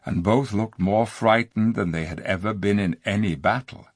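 ordinary delivery, nothing marked

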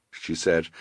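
usual speaking voice

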